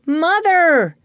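put-on boy's call to mother. John calling his mother.

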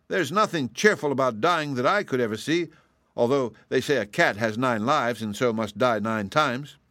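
mildly indignant cat making its case; protesting kitten. A narrator's reading. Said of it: "protested the kitten".

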